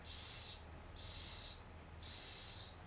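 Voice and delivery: s sorda y sostenida; sonido s. Shown as a demonstration. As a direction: sisea la s como en español. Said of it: "se pronuncia como en español". (s siseante)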